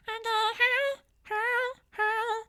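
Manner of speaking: very high, pushed-back falsetto hi; cartoon falsetto demo; high squeezed falsetto. Shown as a demonstration. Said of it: "I don't really know how to explain that one".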